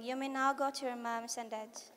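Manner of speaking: ordinary delivery, nothing marked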